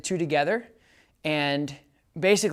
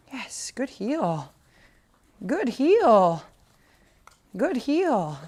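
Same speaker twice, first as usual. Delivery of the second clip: drawn praise; 7 semitones higher, much slower than usual. Real, not acted. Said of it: "help mark the behavior".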